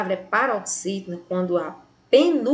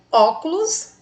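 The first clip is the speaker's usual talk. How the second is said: "óculos" with the weight on the opening "ó"; stress on antepenultimate syllable; 6 semitones higher, 4 dB louder than usual. Shown as a demonstration. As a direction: lean on the first syllable of "óculos". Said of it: "na antepenúltima posição". ("óculos" com o "ó" inicial mais forte)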